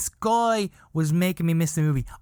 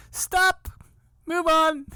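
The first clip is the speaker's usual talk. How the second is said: impatient viewer yelling; much slower than usual. Pretend. Viewer of his own video shouting stop, move on. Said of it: "if I was watching somebody else".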